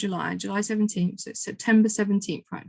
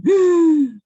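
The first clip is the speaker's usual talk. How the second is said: loud, high startled gasp; sudden frightened intake of breath; sharp gasp; 8 semitones higher, 10 dB louder than usual. Acted. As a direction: gasp as if caught in fear. Fearful part of you reacting.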